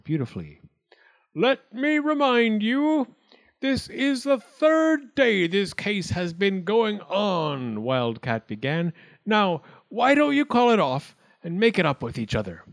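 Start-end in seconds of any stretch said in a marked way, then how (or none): 1.3-7.8 high, slow, loud pronouncement; forced importance. A narrator's reading. Wildcat opens the court to squabbling acorns, self-important. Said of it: "voice was a little nervous, but he forced himself to sound important". 9.2-12.6 high, loud appeal to settle; forced importance. A narrator's reading. Wildcat urges acorns to make up, trying to sound important. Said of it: "voice was a little nervous, but he forced himself to sound important".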